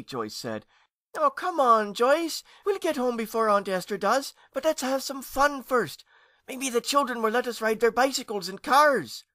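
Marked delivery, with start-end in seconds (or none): 1.2-9.2 put-on high, loud child voice coaxing; coaxing boy. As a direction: talk your sister into it. James coaxing his sister.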